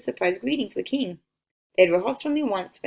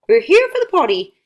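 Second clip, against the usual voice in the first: calling out; 6 semitones higher, 9 dB louder than usual. A narrator's reading. Caravan leader calls out.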